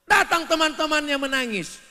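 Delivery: high, loud storytelling; loud narration. Real, not acted. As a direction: announce it. Preacher raises voice. (bercerita dengan lantang)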